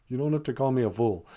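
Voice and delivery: usual speaking voice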